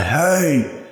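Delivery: put-on high, drawn-out German-accented scolding. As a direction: call out a telling-off in a German accent. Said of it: "That was my German accent".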